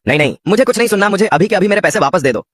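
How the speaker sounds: fast, high, a hammed-up angry refusal; angry outburst. Tinku angrily refusing the waiter, fast mock rant. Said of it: "जोर जोर से गुस्से में बोलने लगा".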